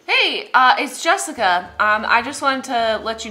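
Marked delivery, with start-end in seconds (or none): none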